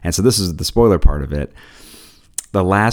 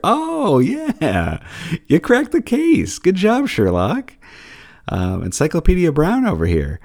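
much higher, sarcastic sing-song praise; mock someone for stating the obvious; snarky fake congratulations; 11 semitones higher than usual. Mocking the mom's obvious remark.

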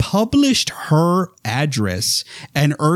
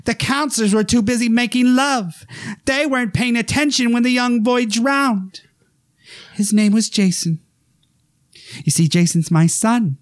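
high, eerie storytelling voice; creepy Mrs Voorhees; 10 semitones higher than usual. Retold or imitated. Mother explains her dead son to the girl, high and eerie. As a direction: tell it sweetly with a sinister edge. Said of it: "my favorite line".

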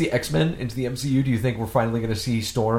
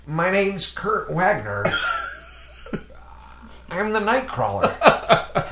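slow, higher mock Nightcrawler voice; drawn-out playful hero voice; Nightcrawler impression; 5 semitones higher, much slower than usual. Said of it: "what do you sound like as Nightcrawler".

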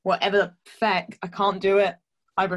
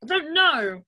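whiny other person; 8 semitones higher than usual. Retold or imitated. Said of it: "other people will be like".

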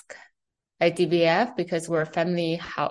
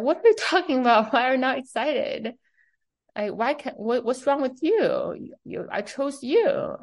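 high, excited disbelief; pitched up, baffled and pushing; incredulous boss; 7 semitones higher than usual. Retold or imitated. The boss pressing her new CEO, in high disbelief.

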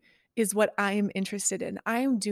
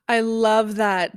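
excited; 7 dB louder, slower than usual. Real, not acted. Enthusiastic reaction to idea.

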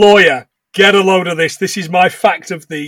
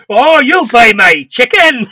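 mock Colonel; 4 semitones higher, 6 dB louder than usual. Voicing the Colonel, laughing.

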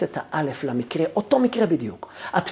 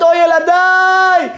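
put-on slow, high wailing cry over his children; a father's anguished wail; 11 semitones higher, 7 dB louder, much slower than usual. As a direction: cry it out, drawn and loud. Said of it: "הוא התחיל לבכות".

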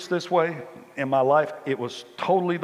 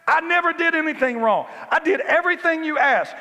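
much higher and louder, indignant shouting; angry older brother complaining; 14 semitones higher, 6 dB louder than usual. Retold or imitated. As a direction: yell the grievance like a wronged son. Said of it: "And he says".

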